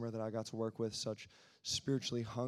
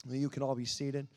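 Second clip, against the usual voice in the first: ordinary talk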